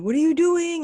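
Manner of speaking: high, loud mock dad barging in; imitating dad barging in. Retelling dad barging into her room, mock loud. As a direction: burst in loudly like an intrusive dad. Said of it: "he would just always barge in and be like".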